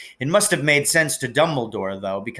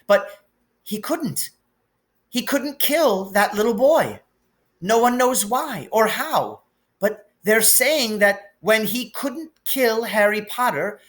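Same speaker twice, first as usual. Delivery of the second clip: put-on high, anxious older woman, voice on the edge of breaking; worried Scottish professor pouring out bad news; trembling McGonagall; 10 semitones higher than usual. A narrator's reading. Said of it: "voice trembled as she went on".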